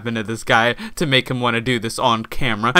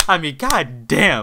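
usual speaking voice

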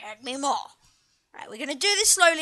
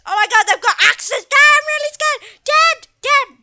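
higher, loud, hammed-up kid's panic; a boy pretending to be terrified; mock-scared child; 10 semitones higher, 9 dB louder than usual. Son frightened of the axe zombies, a high, mock-panicked kid voice.

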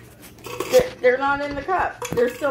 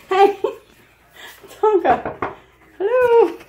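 normal talking voice

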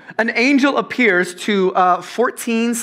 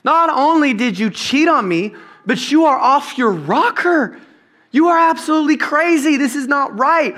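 outraged Joe; 8 semitones higher, 3 dB louder than usual. Retold or imitated. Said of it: "put yourself in his shoes".

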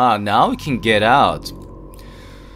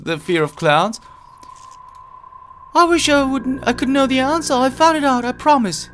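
put-on much higher girlish voice, a touch scared; cute high voice; 4 dB louder than usual. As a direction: play the nervous little girl. Voices game character.

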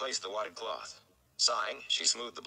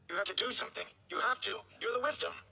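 much higher, pleading and desperate; desperate plea. A narrator's reading. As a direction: beg her as if his father's life hangs on it. Son begs Wisdom to save father.

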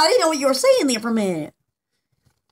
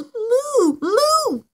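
two separate, higher-pitched moos; imitated refrigerator moo; 5 semitones higher than usual. Telling what the refrigerator goes, imitated mooing noise. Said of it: "the refrigerator goes".

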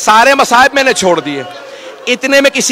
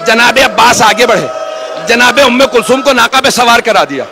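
impassioned sermon recitation; 4 semitones higher than usual. Acted. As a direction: build the mourners toward tears. Majlis recitation rising.

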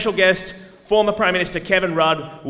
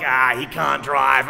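slow, louder mocking Aussie workmate; mock bogan coworker; 5 dB louder, slower than usual. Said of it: "And they're like".

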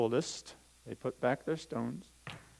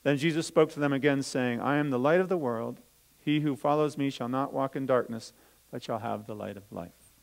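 put-on louder, lively storytelling voice for children; Bible story reading; 4 dB louder than usual. Teacher reading the Bible story to children, lively storytelling. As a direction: read it out as a story to the kids.

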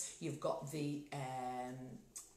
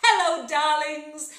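showy hello; 8 semitones higher, 13 dB louder, slower than usual. Acted. Drag host greets the viewers.

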